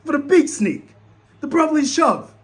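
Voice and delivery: loud, high, disbelieving rant; exasperated yell. Retold or imitated. Fan yelling at his coach's call, exasperated. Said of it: "I'm like".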